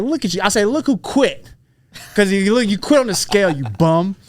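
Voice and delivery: mock taunting a rival; petty taunt. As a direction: needle him pettily, retelling the jab. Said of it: "Me being the petty guy".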